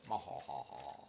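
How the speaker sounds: hammed-up villain laugh; evil laugh. Villainous laugh about owning.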